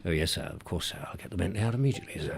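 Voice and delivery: quiet, quick deferential butler; a hint of an eager-to-please butler. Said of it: "Would you be going".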